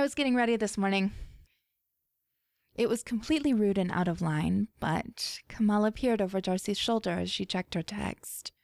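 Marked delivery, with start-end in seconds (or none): none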